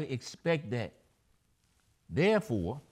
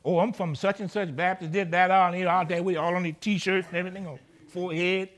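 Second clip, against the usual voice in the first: mock proud churchgoer; 4 semitones higher, 7 dB louder than usual. Mocking a bold Baptist churchgoer.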